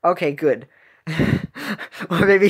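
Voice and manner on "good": ordinary delivery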